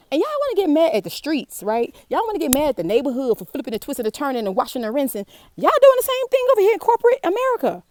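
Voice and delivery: heated rant rising to a high, loud finish; real angry rant. Real, not acted. Railing at viewers over corporate America, mad. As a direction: let the anger build until it bursts out high and loud. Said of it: "I just got a little mad".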